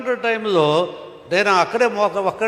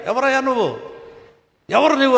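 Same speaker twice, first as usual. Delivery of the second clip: retold shout; 8 semitones higher than usual. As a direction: bark it like a startled watchman. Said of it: "గట్టిగా అరిచాడు". (ఎత్తైన గొంతుతో గట్టిగా అరిచినట్టు)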